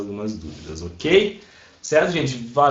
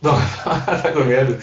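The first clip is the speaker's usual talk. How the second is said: amused laughing; 2 semitones higher than usual. Real, not acted. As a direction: laugh breaking into the words. Teacher laughs at hesitant student.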